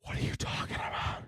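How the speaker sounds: whispered aside. Real, not acted. Unvoiced aside in rant.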